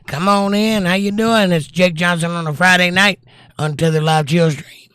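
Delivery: high, projected host welcome; show-opening announcer. Real, not acted. Opens the live show.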